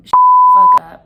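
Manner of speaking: all-out angry yell; furious scream. Retold or imitated. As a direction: scream it in his face. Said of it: "I tell him".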